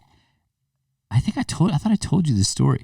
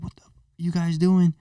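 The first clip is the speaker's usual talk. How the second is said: freaking out; 6 semitones higher, 4 dB quieter than usual. Retold or imitated. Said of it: "freaking out on him".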